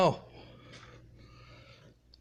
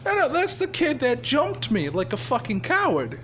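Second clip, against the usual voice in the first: Riddler's indignant complaint; 8 semitones higher than usual. Acted. Riddler complaining about Izuku.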